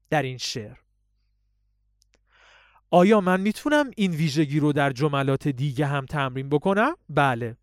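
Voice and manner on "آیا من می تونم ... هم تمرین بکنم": ordinary talk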